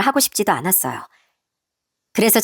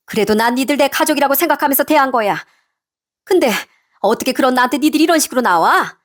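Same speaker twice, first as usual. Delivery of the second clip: scolding mother-in-law, higher than the narration; outraged mother-in-law; 4 semitones higher than usual. Acted. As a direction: berate your daughter-in-law with accusations. Mother-in-law berates daughter-in-law.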